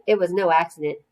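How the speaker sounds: usual speaking voice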